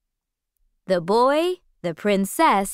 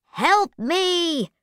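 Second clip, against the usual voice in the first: high urgent cry for help; high cat voice; 8 semitones higher than usual. Acted. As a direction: call out like a desperate cat. Puss calls for help.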